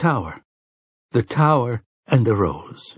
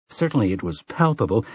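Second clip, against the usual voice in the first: usual speaking voice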